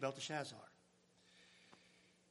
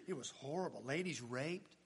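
normal talking voice

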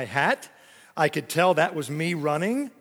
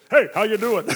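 louder, higher puppet greeting; shadow snake voice; 4 semitones higher, 5 dB louder than usual. Pretend. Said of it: "all I could do was this".

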